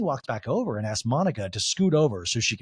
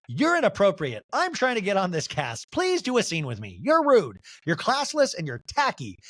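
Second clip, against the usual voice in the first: higher mock scolding; Angie K impression; 5 semitones higher than usual. Castmate telling off the one ignoring her, mock outraged. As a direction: hammer out each insult as an outraged castmate. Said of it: "And Angie K goes".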